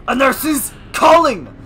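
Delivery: slow, loud perk name; drawn-out announcement. Acted. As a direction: stretch the title out.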